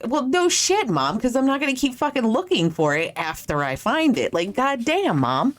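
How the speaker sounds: high-pitched mock exasperation at mom; exasperated retort. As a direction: fire back like an irritated kid.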